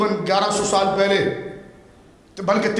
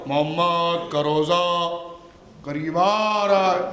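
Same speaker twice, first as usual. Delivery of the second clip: slow, lower, drawn-out mock recitation; mocking naat reciter; 5 semitones lower, much slower than usual. Preacher mocks a young reciter's naat to the crowd, slow and droning. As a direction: mimic a tasteless naat reciter, slowly. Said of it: "یہ کیا ہے؟ ... اس طرح نعت پڑھتے ہیں؟".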